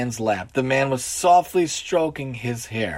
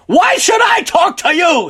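put-on high, loud drunken shout; full-voiced, very high challenge; belligerent drunk; 14 semitones higher, 8 dB louder than usual. A narrator's reading. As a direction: yell it back at him like an angry drunk. Drunk challenges the old man.

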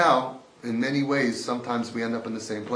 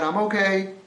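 mock scream; 6 semitones higher, 5 dB louder than usual. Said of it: "he screams out".

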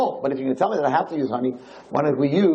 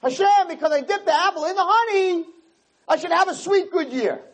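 high, naive sing-song plea; mock smug prayer; 12 semitones higher than usual. Mocking a sinner who skips repentance, praying high and smug. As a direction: ham up a sinner bargaining with God. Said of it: "and I'm gonna say".

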